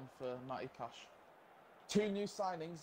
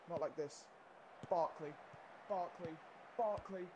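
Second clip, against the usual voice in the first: ordinary talk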